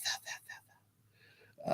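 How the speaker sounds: slow, fading whispered echo; eerie whisper echoing the wheel of death; spooky whisper. Acted. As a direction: breathe it out like a ghostly echo.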